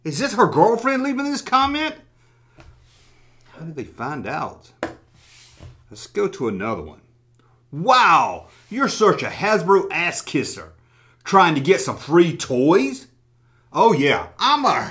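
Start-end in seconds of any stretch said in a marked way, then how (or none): none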